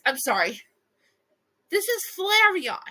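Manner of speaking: ordinary talk, nothing marked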